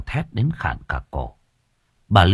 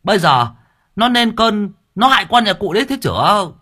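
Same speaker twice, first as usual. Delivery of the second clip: urging; 11 semitones higher, faster than usual. Acted. Servant urging the old man, agitated.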